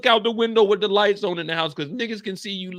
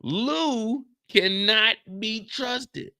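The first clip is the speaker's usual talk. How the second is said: slow dramatic warning; much slower than usual. Acted. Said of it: "slow dramatic pronouncement".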